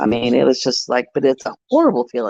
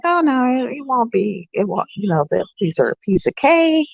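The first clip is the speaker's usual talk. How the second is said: reassuring; 8 semitones higher than usual. Retold or imitated. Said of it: "And I was like".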